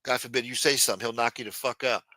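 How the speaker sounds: ordinary delivery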